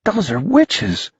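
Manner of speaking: put-on high, slow awed boy; a boy struck with wonder; stunned. A narrator's reading.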